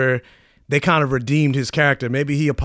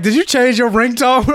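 teasing; 9 semitones higher, 3 dB louder than usual. Retold or imitated. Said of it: "I was like".

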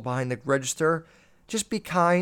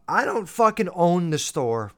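usual speaking voice

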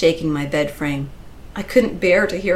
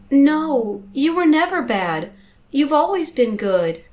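high, emotional comfort; anguished, tender insistence; tearful reassurance; 9 semitones higher, 3 dB louder than usual. A narrator's reading. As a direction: reassure a child while upset. Narrator tearfully comforts the child.

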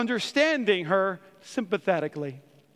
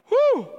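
mock exhausted whew; 12 semitones higher, 4 dB louder than usual. Exhausted husband after birth.

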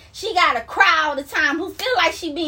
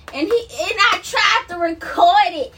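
ordinary delivery

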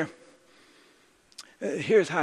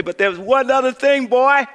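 put-on raised voice, a grandmother calling her boy; grandma's call; 7 semitones higher, 9 dB louder than usual. Pastor voicing his grandma calling out to him loudly.

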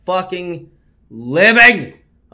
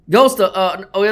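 ordinary delivery

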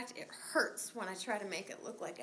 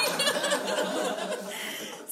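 high laugh; 7 dB louder than usual. Real, not acted. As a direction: burst of high, loud laughter. Laughs at own shoplifting.

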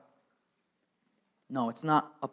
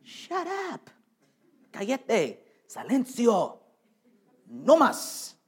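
a lightly voiced crowd hissing to hush him, high; playful jeering at the beggar; a hint of a scolding crowd; mocking crowd; 7 semitones higher, much slower than usual.

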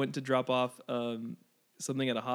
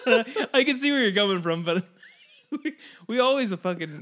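laughing while talking; 7 semitones higher, 4 dB louder than usual. Real, not acted. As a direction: try to answer but the laugh takes over. Laughing at the porn joke.

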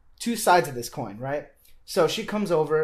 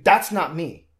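louder, emphatic mock cry of protest; retold inner scream; 7 dB louder, slower than usual. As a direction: reenact a scream held inside, raised but not full. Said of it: "I'm screaming".

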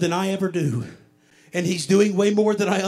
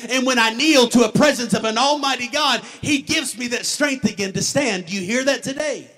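driving sermon delivery, louder and higher than the opening; impassioned preaching; 4 semitones higher, 6 dB louder than usual. Acted. Preacher testifying to the church about strength to stand, impassioned. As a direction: preach it in an unbroken, building run.

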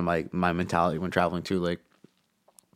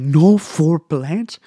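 much higher, louder and very slow, stretched in disbelief; flabbergasted; 9 semitones higher, 10 dB louder, much slower than usual. Retold or imitated. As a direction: drag out the question, stunned. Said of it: "He's like ... flabbergasted".

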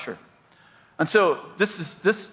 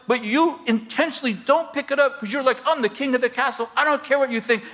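raised, pressing counselor voice; firm confrontation; 6 semitones higher than usual. Retold or imitated. Pastor confronts a clueless husband, raised and pressing. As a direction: lean in and call him out.

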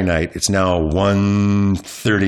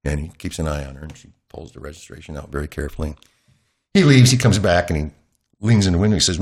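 ordinary talk, nothing marked

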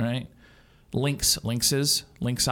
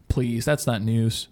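normal talking voice

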